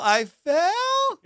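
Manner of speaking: very high, stretched echo; incredulous. Retold or imitated. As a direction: imitate her squeaky disbelief. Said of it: "With this tone".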